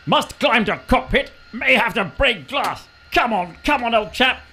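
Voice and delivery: normal talking voice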